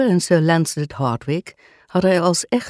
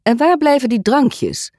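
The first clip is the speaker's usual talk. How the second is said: high, raised-voice demand for drinks; wondering aloud; 11 semitones higher, 4 dB louder than usual. A narrator's reading. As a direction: call out the question, pitched well up. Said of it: "vroeg Guy Burroughs zich hardop af". (hardop en hoog gevraagd)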